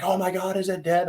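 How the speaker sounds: high, panicky imitation of mom; freaked-out mom. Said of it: "my mom's like, like, freaking".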